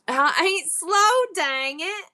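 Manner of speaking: put-on high, slow Southern old-lady protest; indignant mock Southern granny. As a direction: drag it out in a put-on Southern accent. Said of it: "my Southern voice is old lady".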